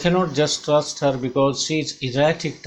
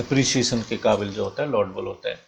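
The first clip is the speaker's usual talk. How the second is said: ordinary talk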